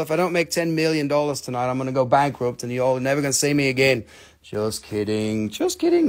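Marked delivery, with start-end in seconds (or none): 4.4-5.5 dropped low, drawn-out "Just kidding."; mock-serious low "Just kidding."; low and slow. Repeat joke two ways. 5.5-6.1 "Just kidding." jumped much higher; playful high-pitched "Just kidding."; high squeak. Acted. Repeat joke two ways.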